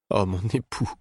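a soft, breaking cry; queen greeting her husband, voice breaking; broken voice. A narrator's reading. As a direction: let the voice crack with grief. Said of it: "d'une voix brisée".

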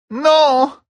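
high, loud despairing moan; a high anguished no. A narrator's reading. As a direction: wail the word out in despair. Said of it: "gémit Aliandre".

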